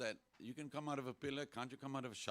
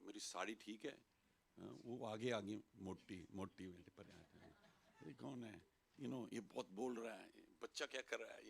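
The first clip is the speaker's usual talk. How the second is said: lightly voiced chit-chat, softer and quick; distracted audience chatter; 3 semitones lower, 8 dB quieter, much faster than usual. Audience in their own worlds, imitated idle chatter. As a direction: murmur it low, absorbed in your own world. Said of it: "they're in their own worlds".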